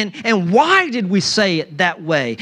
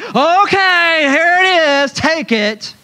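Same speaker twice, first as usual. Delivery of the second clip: high, loud, snippy mock giving; grudging handover; 10 semitones higher, 4 dB louder, slower than usual. Preacher mimics someone grudgingly handing over a shirt, high and snippy. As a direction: toss it over with a resentful huff. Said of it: "you don't just like".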